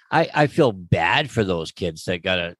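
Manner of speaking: ordinary delivery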